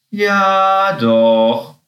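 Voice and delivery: loud, drawn-out confirmation falling from high; emphatic call. A narrator's reading. Chucky confirms it to Happy, calling out. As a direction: call it out, stretching both words. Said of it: "rief Chucky".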